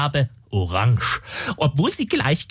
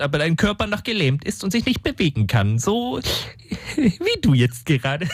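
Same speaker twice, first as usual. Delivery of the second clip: ordinary delivery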